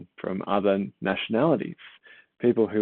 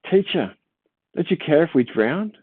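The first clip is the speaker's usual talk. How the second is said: high, louder panicked plea; frightened cry; 7 semitones higher, 4 dB louder than usual. Acted. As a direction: cry out over the storm in fear. Disciples cry out in storm.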